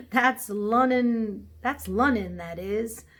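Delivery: "Lunnon" with the d dropped; Cockney "Lunnon". A narrator's reading. Cabby blaming the city, "Lunnon" without its d. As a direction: say "Lunnon" for London, Cockney style. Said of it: "meaning London".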